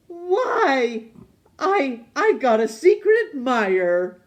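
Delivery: high, loud, delighted outburst; excited exclamation. Acted. Lonely man discovering a secret admirer, loud and high with delight. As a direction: burst out with joy.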